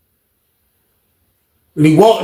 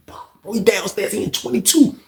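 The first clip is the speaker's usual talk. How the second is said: whisper; 8 dB quieter than usual. Retold or imitated. Telling OG secretly where he is.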